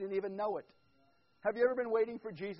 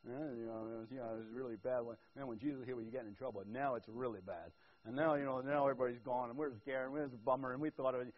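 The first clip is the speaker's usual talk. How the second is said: lower, mopey grumble of a bummed-out follower; a lightly voiced disappointed disciple; gloomy disciple; 7 semitones lower than usual.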